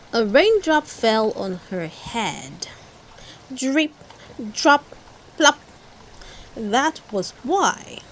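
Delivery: ordinary delivery, nothing marked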